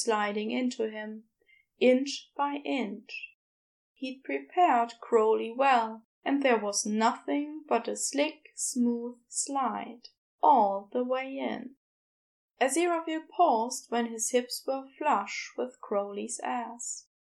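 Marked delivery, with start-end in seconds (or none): none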